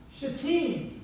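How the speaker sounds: Shittim said shuh-TEEM, second syllable stressed; pronunciation demo. Shown as a demonstration. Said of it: "is pronounced".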